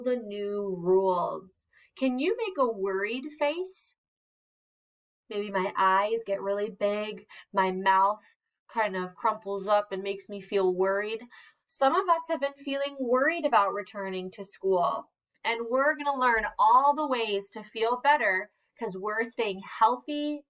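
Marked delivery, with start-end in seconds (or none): none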